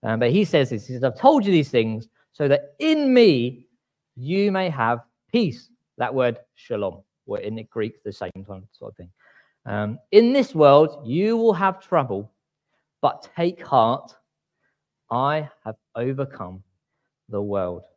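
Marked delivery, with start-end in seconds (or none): 1.0-5.7 slow, weighty reading of Jesus's words; preacher reading the verse aloud, slow and solemn; scripture reading. Acted. 10.1-17.8 preacher quoting Jesus to the church, slow and solemn; scripture reading. Acted. Quoting Jesus from John sixteen.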